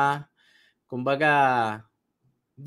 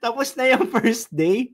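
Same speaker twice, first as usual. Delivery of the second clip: higher, louder mock disbelief; shocked crowd; 6 semitones higher, 3 dB louder than usual. Seminar crowd protests to the speaker, mock shocked and louder. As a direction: act out the crowd's stunned protest. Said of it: "na-shock yung mga tao".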